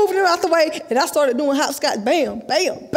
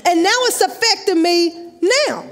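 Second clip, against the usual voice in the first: ordinary talk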